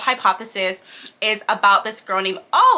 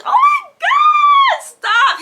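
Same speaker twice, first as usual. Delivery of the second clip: much higher and louder, slow, giddy shriek; swooning squeal; 6 dB louder, much slower than usual. Retold or imitated. Reacting to his line in the book, a giddy high squeal. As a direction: melt over him out loud. Swooning over the love interest.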